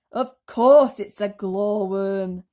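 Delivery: loud, slow know-it-all voice; centipede. A narrator's reading. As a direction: say it as the obvious. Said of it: "the centipede answered".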